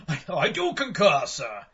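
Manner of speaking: laughing stuffy posh voice; pompous gentleman through laughter. Retold or imitated. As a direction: echo the aristocrat while laughing. Echoing the posh reply laughing.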